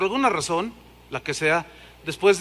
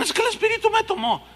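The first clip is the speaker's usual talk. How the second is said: high mocking voice of a worshipper; imitating someone claiming the Spirit; mock ecstatic claim; 13 semitones higher than usual. Preacher mocking a worshipper who claims the Spirit, high voice.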